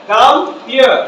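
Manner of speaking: "Come here" as two full words; learner pronunciation. Shown as a demonstration. Teacher presents the sentence, separate "Come here". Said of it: "আমরা বলি না".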